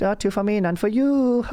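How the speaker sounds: high, gleeful German accent with a chuckle; wily German accent. Shown as a demonstration. Nazi keeping the Twix to himself, in a wily German accent. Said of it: "this sort of wily one".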